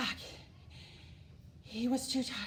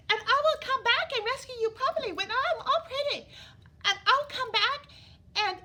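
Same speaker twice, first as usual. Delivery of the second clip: high, louder, mock-agreeable princess; sarcastic princess; 11 semitones higher, 6 dB louder than usual. Elizabeth mockingly agreeing with Ronald, high and sarcastic. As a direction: agree sweetly while mocking him, higher. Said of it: "this is what Elizabeth said".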